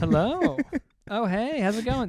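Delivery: high, bright host greeting voice; chirpy club host welcoming guests; imitating his host greeting. To arriving guests, a cheerful host greeting. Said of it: "That's what I do".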